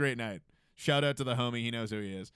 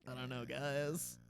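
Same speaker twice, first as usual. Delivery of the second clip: retold reluctance; 9 dB quieter, slower than usual. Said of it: "I'm like".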